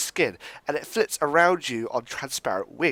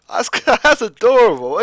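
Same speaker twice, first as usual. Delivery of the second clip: charmed laugh; 8 semitones higher, 9 dB louder than usual. Real, not acted. Charmed by the tiny creature.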